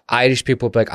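ordinary talk

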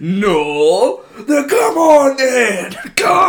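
high, loud, slow taunting yell; hammed-up fight challenge; mock brawl challenge. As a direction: bellow the dare to fight. Quoting movie fight.

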